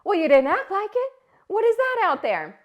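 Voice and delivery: high, loud and fast snap back; sharp retort. Retold or imitated. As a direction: cut her off sharply. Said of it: "And I'm like".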